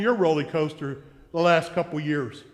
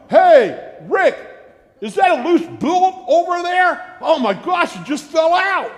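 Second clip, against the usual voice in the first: loud, high mock alarm to scare mom; fake-gasp about a loose bolt, higher and louder; teasing boys; 11 semitones higher, 9 dB louder than usual. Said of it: "And we go".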